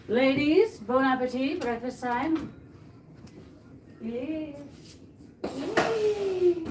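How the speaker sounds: high cheerful call to the cats; high sing-song pet talk. Real, not acted. Calling cats to breakfast.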